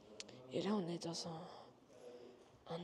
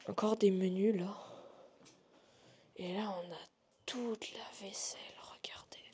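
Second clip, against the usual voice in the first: nervous night vlog whisper. Real, not acted. Showing dishes, whispering.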